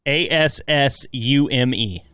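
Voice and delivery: letter by letter, slowly; spelling it out. Shown as a demonstration. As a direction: spell it out one letter at a time. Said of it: "Spell it for me".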